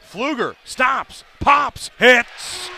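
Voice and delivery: announcer calling a big jumper as it drops; excited call. Real, not acted. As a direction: build to the make. Play-by-play on a made jumper.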